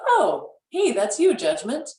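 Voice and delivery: high, friendly greeting to an inner critic; cheery hello. Pretend. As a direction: greet your judgment like an old pal.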